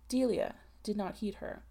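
usual speaking voice

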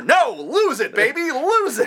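high, excited mock urging; over-the-top excited cheering-on; hammed-up egging on. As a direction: goad them on, pitch climbing. Acted egging-on to lose temper.

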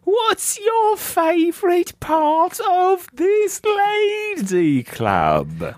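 very high, hammed-up English lady voice; subdued English lady. Greeting the ladies for the listener, high English lady accent. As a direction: falsetto mock English lady, stretched out. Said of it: "subdued English lady".

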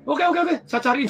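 high, loud eager okays. Retold or imitated. Manager eagerly agreeing to the aunt's request. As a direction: jump at the request keenly.

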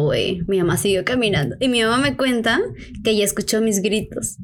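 normal talking voice

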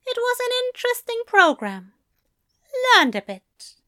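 high-pitched, chatty angel voice; high voice for a fussy friend; prim angel. Acted. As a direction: voice the angel making light conversation.

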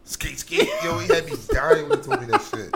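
high laughing delivery. Real, not acted. Friend recalling the skeet text, laughing high. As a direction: squeak it out while cracking up.

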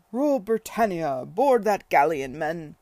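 booming naval war cry; rally the troops at full voice; happy bellow. Acted. Tom leading his children in mock battle, booming bellow. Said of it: "he was bellowing happily".

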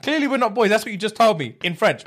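high, hurt mock complaint; retold indignation. Storyteller disowning the friend who hid his French, mock-aggrieved. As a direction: declare the friendship over, half-joking. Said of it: "I was so angry".

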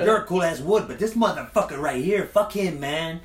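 high, loud you're a cool ass wood, then a high fuck him; loud, rowdy gang voice; loud gang approval. Retold or imitated. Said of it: "It's like".

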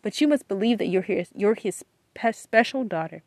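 normal talking voice

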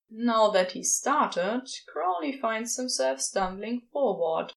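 ordinary delivery, nothing marked

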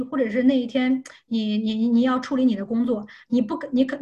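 normal talking voice

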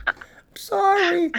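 high, slow squeaky sorry; apologetic patient. Pretend. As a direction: draw out a meek apology. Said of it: "Some German doctor".

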